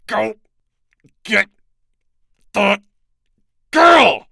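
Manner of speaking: strained, rising to a high loud cry; through gritted teeth. Acted. Chapman fights his own mouth to order his wife, strained. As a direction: force each word out against resistance. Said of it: "he said through gritted teeth".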